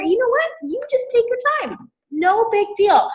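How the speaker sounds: high, softened sing-song comfort; sweetly unbothered; easygoing reassurance. Acted. Acted reassurance to slow builders.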